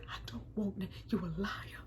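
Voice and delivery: a hurt whisper. Retold or imitated. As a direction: breathe it out, wounded. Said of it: "giving that great".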